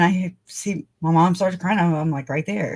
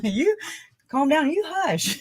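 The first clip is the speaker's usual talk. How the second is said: mock retort; 9 semitones higher than usual. Acted retort to the cop.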